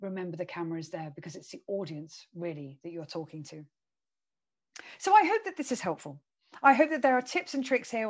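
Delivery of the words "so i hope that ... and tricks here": bright upbeat. Real, not acted. Warm closing to audience.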